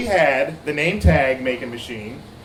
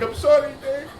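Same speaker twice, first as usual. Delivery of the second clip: high, meek apology in a put-on Ugandan accent; apologetic foreign student; 8 semitones higher than usual. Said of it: "I'd be like".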